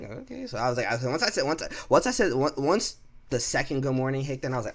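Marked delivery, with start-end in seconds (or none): 0.0-0.5 very high, soft and rising; small questioning squeak; puzzled okay. Retold or imitated. Worker ignored by coworker, high soft puzzled okay.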